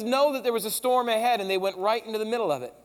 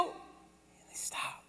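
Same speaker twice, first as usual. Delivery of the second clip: sudden hushed whisper; 19 dB quieter, much slower than usual. Acted. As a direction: drop to a breath as the storm dies. Storm stops, sudden hush.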